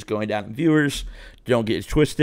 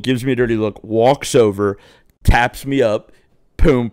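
ordinary talk, nothing marked

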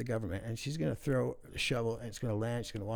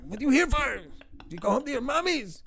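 high, loud mocking boss voice; yelling French boss; 7 dB louder than usual. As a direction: squeal it like the pumped-up boss yelling at the crew. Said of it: "he's always yelling at everybody".

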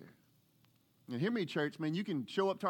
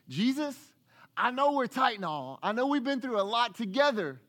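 mild Peter voice, pitched up; 7 semitones higher, 5 dB louder than usual. Acted. Peter voiced pleading with Jesus.

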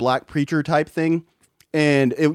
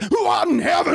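shouted far higher, a mock preacher's scream; much higher imitated screaming of the Lord's Prayer; 13 semitones higher than usual. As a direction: ham up a funeral prayer yelled to heaven. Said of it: "he's screaming it".

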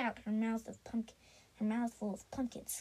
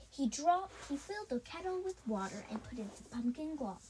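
usual speaking voice

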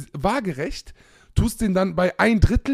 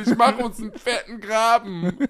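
usual speaking voice

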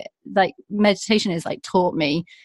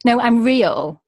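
ordinary talk

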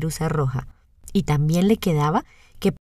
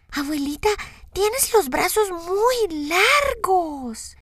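niña aguda, muy lenta y asombrada; voz de niña; 12 semitones higher, much slower than usual. A narrator's reading. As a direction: pregunta curiosa de niña pequeña. Voz de Caperucita. (niña asombrada)

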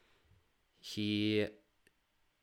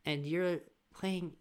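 put-on high, incredulous kids' voice, a whisper in the middle; bewildered children grilling their father; kids questioning dad; 9 semitones higher, 5 dB louder, slower than usual. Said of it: "with the kids wondering".

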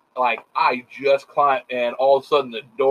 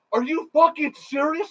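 retold scream; 11 semitones higher than usual. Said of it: "Starts screaming".

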